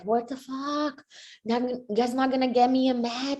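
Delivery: retold high-pitched indignation; exasperated outburst. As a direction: act out being fed up with the matchmaker. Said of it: "I've been crazy angry".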